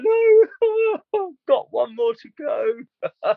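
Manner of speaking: high, crying through a laugh; tearful laugh. Real, not acted. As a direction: laugh through the tears, slowly.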